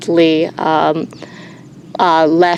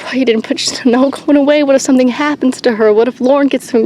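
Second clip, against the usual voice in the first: mild mock yell, pitched up; retold yelling at wife; 6 semitones higher, faster than usual. Yelling at his wife in the car over the sheep, mild mock yell. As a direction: replay the angry yell at her, half-hearted. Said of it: "like, yelling in the car".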